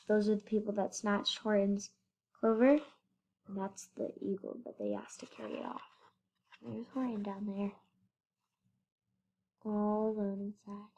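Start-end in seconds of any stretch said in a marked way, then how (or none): 3.4-5.8 much softer, low murmur; softly pointing out the picture; hushed aside. Real, not acted. 6.6-10.9 hushed whispered aside; soft whisper about lonely Horton. Real, not acted.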